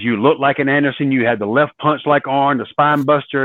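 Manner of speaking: ordinary talk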